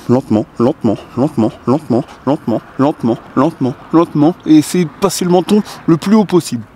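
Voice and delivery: repeated cue climbing in pitch under effort; pull-up tempo cue. Shown as a demonstration. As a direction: chant it in steady beats while pulling up. Counts tempo during slow pull-up demonstration.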